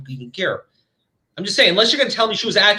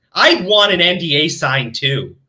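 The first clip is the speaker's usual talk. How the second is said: ordinary talk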